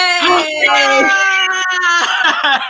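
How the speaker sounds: gasping shriek with a laugh; excited gasp. Real, not acted. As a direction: gasp and squeal with joy. Match found in guessing game.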